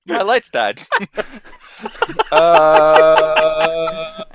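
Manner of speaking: ordinary talk, nothing marked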